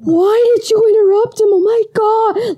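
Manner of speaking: put-on high, mortified teen whine; embarrassed teen. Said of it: "he's like".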